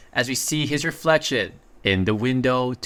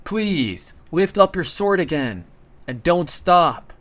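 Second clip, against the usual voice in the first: higher-pitched, urgent plea without full volume; mild narrated yell; 5 semitones higher, slower than usual. A narrator's reading. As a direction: read it as a restrained cry to a comrade. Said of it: "he yells out".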